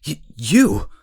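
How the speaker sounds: stammered high, loud cry of shock; startled accusation. Acted. Hibiki spots the intruder, shocked stammer. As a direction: point at her in alarm.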